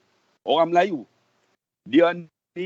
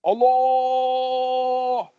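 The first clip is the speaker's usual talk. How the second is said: long sustained high call to God; drawn-out imploring wail, acted; imitated weeping cry; 4 semitones higher than usual. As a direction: retell his cry as one held, raised note. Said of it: "dia menangis".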